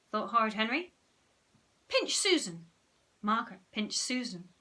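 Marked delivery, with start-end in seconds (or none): 1.9-2.7 high, crisp order; testing command. A narrator's reading. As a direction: order a subject to act. Henry tests his power.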